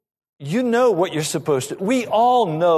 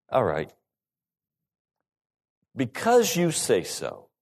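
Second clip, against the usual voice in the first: grudging attitude. Acted. Peter giving in to the rabbi, with attitude. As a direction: concede slowly with attitude. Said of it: "So with an attitude, he says".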